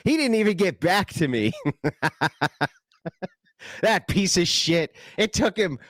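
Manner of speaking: high, loud hammed-up indignation with laughs breaking in; mock outrage. Host fuming at the guest's late reply, hammed-up and high. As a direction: play the wounded diva, squealing at the insult.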